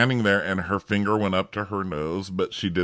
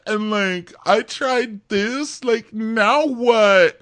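put-on much higher, slow whiny girl voice; whiny contestant; 4 dB louder, slower than usual. Mocking the kicked-off sports lady, high whiny. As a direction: whine it like a lovelorn reality girl. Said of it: "She's like".

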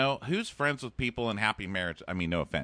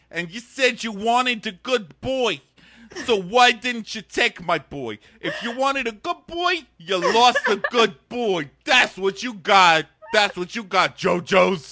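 mock angry mom; 11 semitones higher, 8 dB louder than usual. Mom yelling at JoJo on show.

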